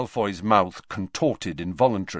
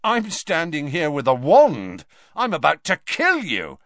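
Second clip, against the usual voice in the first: higher and louder threat; threatening; 7 semitones higher, 6 dB louder than usual. A narrator's reading. Malfoy threatening the old headmaster, loud. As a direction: bluster the threat.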